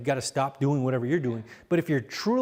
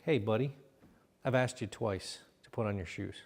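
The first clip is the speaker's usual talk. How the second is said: low, soft and level; patient, quiet firmness; calm request; 8 semitones lower, 9 dB quieter than usual. Shown as a demonstration. Coach showing a parent calm request about shoes, low and soft. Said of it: "better response".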